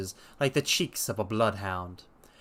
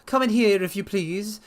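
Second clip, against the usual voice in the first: higher, louder hurried invitation; anxious resident patient; 8 semitones higher, 3 dB louder than usual. Acted. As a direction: usher them in, pitched up. Blessington invites them.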